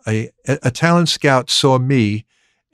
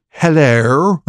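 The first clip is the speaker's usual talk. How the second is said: imitated English accent hello; 2 semitones higher than usual. Distinguished gentleman at the water cooler says hello, English accent. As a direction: greet in a put-on English accent. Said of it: "in, in an English accent".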